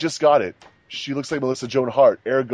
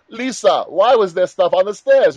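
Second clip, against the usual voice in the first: higher, louder whiny Tommy complaint; mock Tommy; 7 semitones higher, 6 dB louder than usual. Friend mocks Tommy finding stuff on the stairs, higher and louder. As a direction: play the hurt boyfriend calling out to Lisa. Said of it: "he'd be like".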